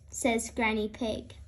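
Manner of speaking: plain reading voice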